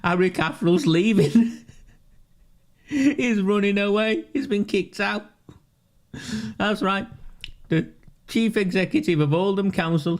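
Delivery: can barely get it out for laughing; gleeful gossip, laughing through the words; giggling. Real, not acted. Gleeful gossip about chief executive.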